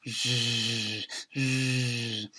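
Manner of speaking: buzzing cartoon snore; long buzzing "zzz" of someone nodded off; dozing snore. A narrator's reading. Acting out the old lady snoring.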